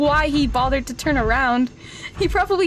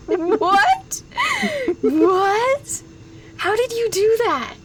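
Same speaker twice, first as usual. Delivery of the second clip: incredulous; 6 semitones higher, much slower than usual. Real, not acted. Chat redeem came true.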